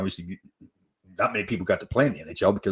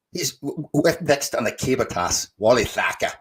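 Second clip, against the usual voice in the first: slow mock Scottish accent; attempted Scottish accent; 4 semitones higher, 3 dB louder than usual. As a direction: draw it out in a playful Scottish accent. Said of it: "that's my Scottish".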